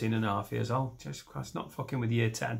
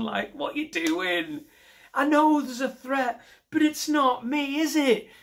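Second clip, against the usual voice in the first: hammed-up indignation; exasperated high rant; 9 semitones higher, slower than usual. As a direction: vent fury at airport security. Ranting at airport security.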